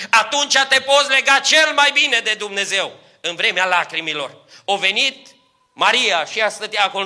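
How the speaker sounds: preacher thundering to the congregation about tears; preaching at full force. Acted. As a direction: lift the church with a ringing sermon.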